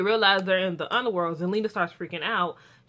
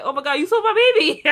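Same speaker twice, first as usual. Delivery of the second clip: high, loud, laughing mock outburst; mock excitement; 11 semitones higher, 10 dB louder than usual. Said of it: "so she's excited".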